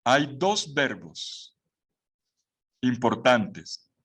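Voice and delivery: ordinary talk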